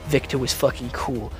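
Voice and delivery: ordinary delivery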